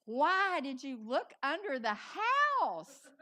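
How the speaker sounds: loud, slow, stretched-out question; hammed-up astonished demand; incredulous husband. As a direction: exaggerate the bewildered husband, drawing out the last word. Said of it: "and he said".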